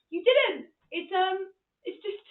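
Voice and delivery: high, bright-pitched reassurance; high-voiced mock consolation; placating reply. Reassuring dad, acted high.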